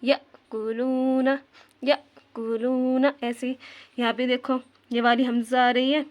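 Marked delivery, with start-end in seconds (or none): 0.0-3.1 यअकुलूना with a slight catch on the अ, drawn out slowly; यअकुलूना slowly, a light jerk at the hamza. Shown as a demonstration. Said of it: "हल्का सा झटका लेकर पढ़ेंगे". (यअकुलूना हल्का सा झटका लेकर, धीरे)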